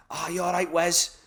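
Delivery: lad-to-legend Scouse patter; cheeky fake Scouser greeting; mock Scouse. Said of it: "I sound like a bit of a scouser".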